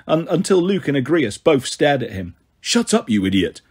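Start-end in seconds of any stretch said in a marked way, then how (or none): 2.6-3.6 low gruff creature growl. A narrator's reading. Bear-man rebukes his laughing brother, low growl. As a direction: growl it deep at a foolish brother. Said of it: "Agrias growled".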